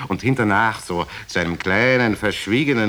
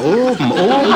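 punchline squeal; 11 semitones higher, 7 dB louder than usual. Acted. Punchline about the walking stick.

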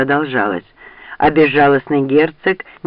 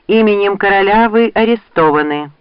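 higher, emphatic proclamation; official command; 6 semitones higher, 4 dB louder than usual. A narrator's reading. Knight arrests Rand in the king's name, official command. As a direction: proclaim it with formal authority.

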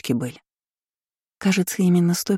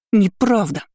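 higher, protesting cry; indignant denial; 4 semitones higher than usual. A narrator's reading. Narrator jumps up indignant. (возмущённо)

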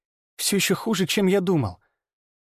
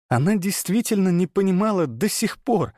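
ordinary delivery, nothing marked

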